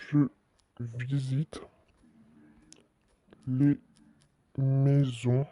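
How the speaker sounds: word by word with long pauses; slow dictation. Real, not acted. Streamer typing out a house-visit announcement, halting word-by-word dictation. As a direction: say each word as you type it.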